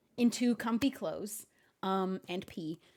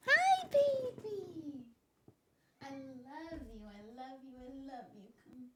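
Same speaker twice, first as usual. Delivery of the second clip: soft, slow, much quieter cooing; 2 semitones higher, 4 dB quieter, slower than usual. Real, not acted. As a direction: coo softly to a pet. Cooing to her cat.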